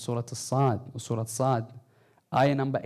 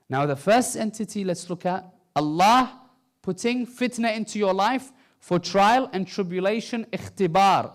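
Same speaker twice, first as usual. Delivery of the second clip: higher, louder sermon delivery; forceful preaching; 6 semitones higher, 8 dB louder than usual. Real, not acted.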